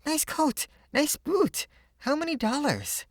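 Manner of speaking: put-on high, eager little girl voice; a small girl admiring his clothes; curious little girl. A narrator's reading. Little girl admiring her friend's new clothes, high and eager. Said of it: "she queried".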